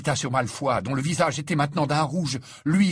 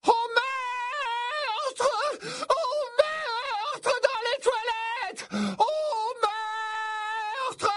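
very high, long-drawn wailing scream; Mimi Géniard screaming; much slower than usual. A narrator's reading. Ghost girl raising the alarm for murder, howling. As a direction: wail each word out like a siren. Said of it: "poussa soudain un hurlement assourdissant".

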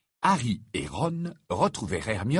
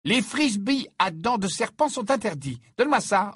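high-pitched, severe command; stern order; 11 semitones higher, 3 dB louder than usual. A narrator's reading. Hermione confiscating a pupil's frisbee, stern. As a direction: lay down the law, voice raised high. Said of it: "ordonna-t-elle d'un ton sévère".

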